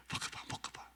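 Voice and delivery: hushed devilish whisper; tempting whisper. Acted. Devil whispering to Eve to eat. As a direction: whisper it into her ear, insistent.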